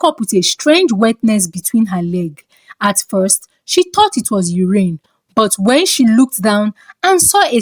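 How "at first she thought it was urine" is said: plain reading voice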